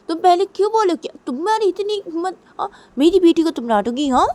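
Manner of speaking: high, heated quarrelling; angry retort. Acted. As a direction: fire the blame straight back at your partner. Father quarrels back with mother. (झगड़ते हुए, गुस्से में)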